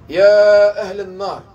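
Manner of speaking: slow, loud summons; loud, drawn-out call. Acted. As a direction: call across to the people of hell. God calls the people of hell.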